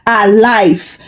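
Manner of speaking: ordinary delivery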